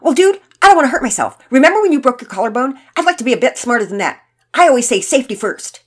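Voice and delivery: fast, high, loud gabble; torrent of words. A narrator's reading. Todd justifying himself to Pearson, in a torrent. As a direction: rush out the excuses defensively. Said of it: "came in a torrent of words".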